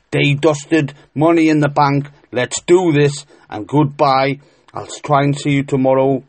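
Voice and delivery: loud, upbeat sign-off; excited. Real, not acted. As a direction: punch it out, pleased with the tips.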